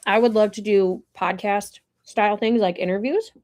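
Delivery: ordinary talk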